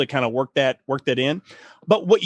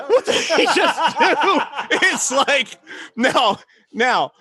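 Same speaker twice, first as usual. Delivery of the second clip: laughing in disbelief; 11 semitones higher, much slower than usual. Real, not acted. Shocked laughter at the dog story.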